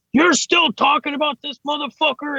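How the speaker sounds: high mock yell across the site; retold yell. Foreman calling over from another lift, high mock yell. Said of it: "he's just yelling at me from a lift".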